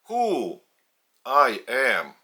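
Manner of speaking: usual speaking voice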